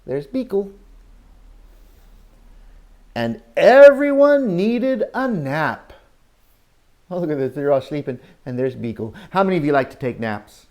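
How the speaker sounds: ordinary talk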